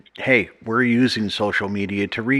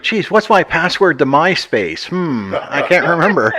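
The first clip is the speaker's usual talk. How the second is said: mock confused; 7 semitones higher, 4 dB louder than usual. Mock confused user, laughing.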